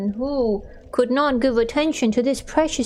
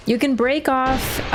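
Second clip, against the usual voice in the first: usual speaking voice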